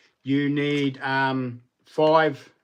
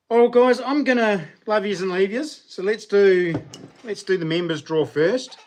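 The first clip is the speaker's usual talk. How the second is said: loud cheerful sign-off; upbeat; 6 semitones higher, 5 dB louder than usual. Real, not acted. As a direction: pump up the audience. Wrapping up the stream.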